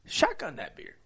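high, punchy hype-man storytelling; preaching. Acted. Host preaching a beer shotgun to listeners, high-pitched preaching. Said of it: "my preaching of a shot".

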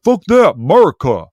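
hammed-up party bro shout; mock rowdy cheer. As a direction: belt it like a drunk patriot at a barbecue. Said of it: "they're like".

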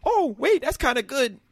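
a fan suddenly impressed despite himself, voice leaping high; hammed-up delighted double take; mock pleasant surprise. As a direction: pitch jumps way up on wait, imitating a fan won over. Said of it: "Then it's like".

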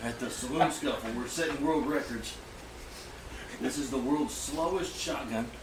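hushed, soft golf announcer voice; whisper-quiet sportscaster calling the green; golf commentator. Acted. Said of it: "You have the perfect golf announcer voice".

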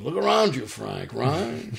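joking impression with a swooping, questioning finish; Armand Assante. Actor jokes around with a castmate's voice, mock impression. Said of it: "we joke around with his voice".

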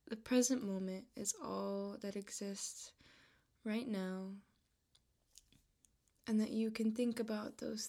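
calm, unhurried voice with long pauses between phrases; measured, even-toned meditation guide; guided meditation. Real, not acted. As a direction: ease the listener into relaxation, leaving room to breathe.

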